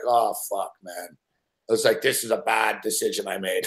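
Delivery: low, hushed self-reproach; dropping low and soft with regret; quiet regret. Retold or imitated. Shaky, regretting the bet.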